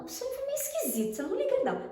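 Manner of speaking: mock high-pitched, sheepish friend; apologetic preface. Friend prefacing her strange dream in a voice message, imitated sheepishly.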